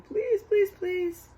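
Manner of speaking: high pleading please; begging. Acted. Lulu begging in picture.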